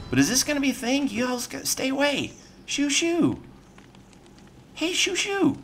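high, anxious shoo shoo; nervous shooing. Real, not acted. Gamer shooing snakes away, high and anxious. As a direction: voice climbing as they creep closer.

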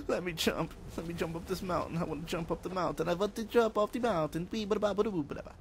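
goofy high chant that runs into fast nonsense; playful pleading babble. Pretend. Playful frustration at failed jumps.

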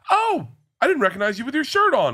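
high, eager female voice; excited woman fan. Retold or imitated. Woman at the airport lounge asking him, high and eager. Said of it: "Then she just goes".

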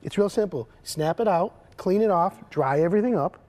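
ordinary delivery

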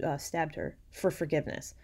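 ordinary delivery

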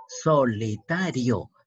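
slow, stretched "solitario", so-li-ta-rio; syllable by syllable. Shown as a demonstration. Teacher showing the diphthong, "solitario" slowly syllable by syllable. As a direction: draw out "solitario" one syllable at a time.

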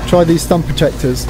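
ordinary talk

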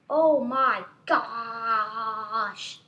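long drawn-out, loud OH MY GOSH; amazed outcry. Real, not acted. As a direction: stretch it into a loud wail of amazement. Amazed at own game character.

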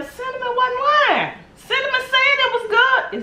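very high, loud playful voice; high sing-song. Pretend. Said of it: "you gonna say".

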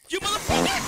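high, loud indignant yell; angry outburst. Real, not acted. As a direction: burst out in outrage. Sudden outburst at game.

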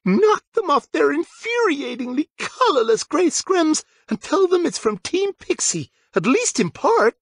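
put-on high, grim girl voice; grim pixie captain. A narrator's reading. Ophelia urging the boys on, grim. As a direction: grimly, still in her high voice.

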